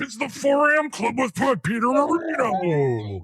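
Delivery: high hyped announcer shout; monster truck announcer. Retold or imitated. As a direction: hype it like a rally ad. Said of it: "sounds like you when you're doing Peter Marino".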